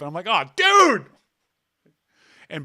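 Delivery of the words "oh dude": loud, high mock protest; mock outraged cry. Protesting loudly to his friend who just outed him, mock outrage. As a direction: yelp it like a betrayed buddy. Host retells reaction to being exposed.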